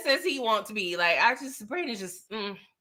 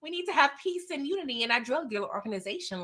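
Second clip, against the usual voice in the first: high, fast, soft whiny voice; lightly voiced whiny earnest kid; mocking Brayden; 4 semitones higher, 6 dB quieter than usual. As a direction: make fun of his earnest naivety. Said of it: "He was like".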